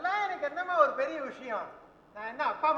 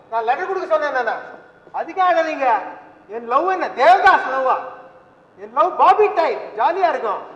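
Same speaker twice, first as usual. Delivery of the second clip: loud protest; 9 dB louder than usual. Acted. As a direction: shout it out, dragging the words. Son loudly protests to crying woman. (உரத்த குரலில் கோபமான வாதம்)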